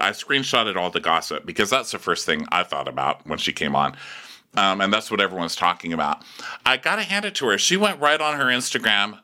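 ordinary talk, nothing marked

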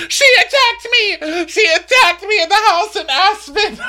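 very high, loud wailing; mock hysterical shriek. Castmate claims an attack in Aspen, mock hysterical shriek. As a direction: screech it like a hammed-up victim.

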